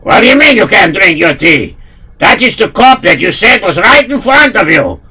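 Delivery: puzzled Russian henchman; Russian accent. Acted.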